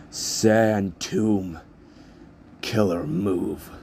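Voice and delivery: lightly shouted attack call, drawn out at the end; mild yell. A narrator's reading. Izuku calls his move at the zero pointer, mild battle yell. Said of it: "raises up one of his hands and yells".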